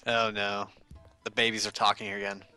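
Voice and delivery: ordinary talk, nothing marked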